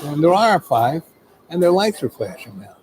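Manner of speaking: ordinary delivery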